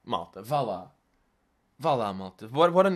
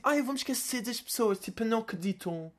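mocking whiny voice; 7 semitones higher, 5 dB quieter than usual. Parodying the influencer's voice.

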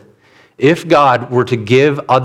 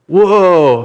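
put-on startled toddler; 5 semitones higher than usual. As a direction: sound caught off guard by the noise. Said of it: "He's like".

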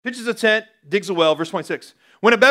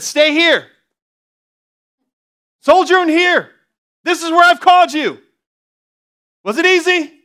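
preaching loud; 11 semitones higher, 9 dB louder than usual. Acted. Preacher booming God's words to the church. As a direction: voice of the Lord, raised high and loud. Preacher voicing God, rising intensity.

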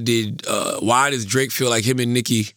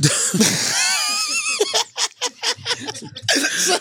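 laughing fit. Real, not acted. Laughing fit over Duppy.

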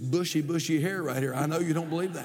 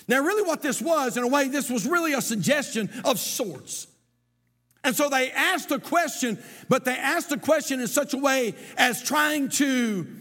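higher, louder sermon delivery with a sing-song lilt; full-force preaching; 7 semitones higher, 3 dB louder than usual. Acted. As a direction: preach it out to the congregation.

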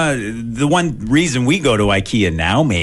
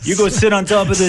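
high put-on heckler voice; mock heckling; 10 semitones higher, faster than usual. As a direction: play a taunting player, pitched way up. Imagined player heckles the umpire.

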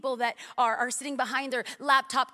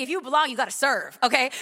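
normal talking voice

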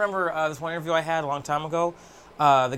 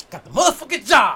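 triumphant shout; 6 semitones higher, 7 dB louder than usual. Retold or imitated. Celebrating after phone interview.

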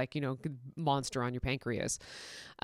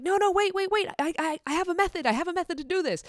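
high, fast eager interruption; eager colleague; 13 semitones higher, 4 dB louder, faster than usual. Retold or imitated. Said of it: "Kim was like".

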